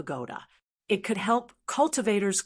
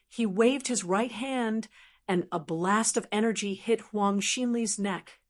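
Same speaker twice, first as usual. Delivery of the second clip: ordinary delivery, nothing marked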